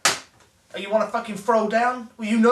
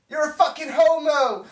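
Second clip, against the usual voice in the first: higher, louder mocking shout; prank yell; 7 semitones higher, 4 dB louder than usual. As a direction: yell the insult down the phone. Prank call punchline insult.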